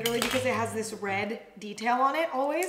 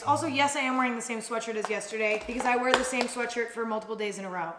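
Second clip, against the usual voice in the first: ordinary delivery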